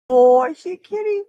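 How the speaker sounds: high, pleased cat talk. Real, not acted. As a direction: coo at the cat. Calling to a cat.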